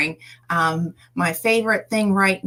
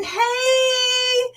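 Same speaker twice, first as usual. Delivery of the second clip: excited call. Real, not acted. Greeting a viewer in chat.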